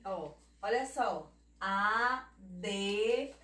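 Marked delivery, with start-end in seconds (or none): 1.6-3.3 "A, D" as two separated sounds, "a... dê"; "A, D" spaced out for dividing admirar; syllable division. Shown as a demonstration. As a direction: split off A, then name the D. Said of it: "Ela sempre fica na sílaba anterior".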